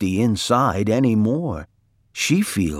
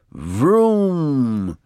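car-engine vroom; 5 semitones higher than usual. A narrator's reading. Mister Watson dreaming of driving a fast car, drawn-out engine noise. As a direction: imitate a revving car in your sleep. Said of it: "said Mister Watson in his sleep".

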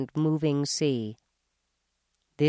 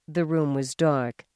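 usual speaking voice

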